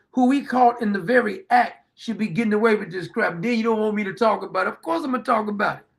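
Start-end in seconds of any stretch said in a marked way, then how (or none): none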